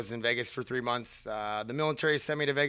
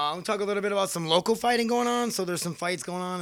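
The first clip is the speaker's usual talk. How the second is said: announcer voice; 6 semitones higher than usual. Acted. Announcer mode carried on.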